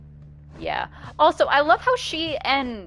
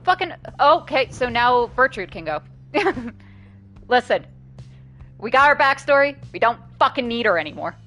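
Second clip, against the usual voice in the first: excited; 4 semitones higher, 5 dB louder than usual. Real, not acted. Gleeful outburst after dialogue.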